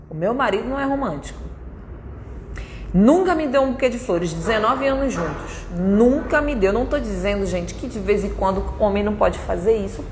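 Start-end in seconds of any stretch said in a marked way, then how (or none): none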